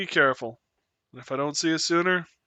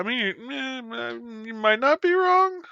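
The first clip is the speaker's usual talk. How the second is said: high-pitched; 9 semitones higher than usual. Real, not acted. Playful reply to a chat joke.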